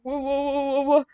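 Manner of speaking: slow stuttering question; stammered question. A narrator's reading. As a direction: stammer it out, thrown off. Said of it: "Max asked".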